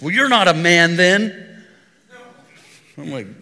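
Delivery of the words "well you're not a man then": put-on high, loud kid's taunt; mock child jeering, high and loud; imitating a little kid. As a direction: pipe up like a cocky boy. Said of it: "he pipes up and he goes".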